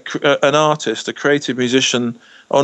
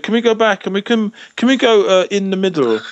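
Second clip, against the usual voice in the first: imitating a very proper English accent, pitched higher; 6 semitones higher than usual. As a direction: mimic Mike's correct, polished English request. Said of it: "Mike has the most proper English voice you'll ever hear".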